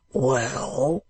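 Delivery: gruff, drawn-out word. Acted. Man below wall answering old gentleman, gruff. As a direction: begin gruffly. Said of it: "answered the gruff voice".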